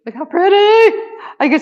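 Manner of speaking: excited high squeal on pretty; squealing look how pretty. Real, not acted. Gushing over a keychain.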